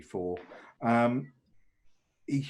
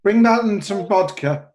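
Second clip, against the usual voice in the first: calls out loud and high toward the back room; shouting to the back room; 8 semitones higher, 7 dB louder than usual. Acted. Host shouting to the servants in back, loud call. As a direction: bark an order for ice water and vodka. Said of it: "turns towards the back room and shouts".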